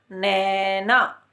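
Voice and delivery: नैना drawn out very slowly; slow demo of नैना; ai sound. Shown as a demonstration. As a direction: stretch the ऐ in नैना. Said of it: "इसका उच्चारण करते हैं नैना".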